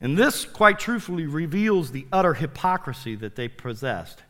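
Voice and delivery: ordinary delivery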